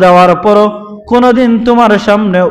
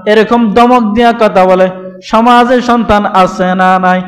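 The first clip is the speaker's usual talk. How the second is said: ordinary delivery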